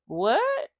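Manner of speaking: high, rising stunned question; shocked what. Retold or imitated. Hearing the news from the social worker, stunned and high. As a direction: she can't believe what she heard.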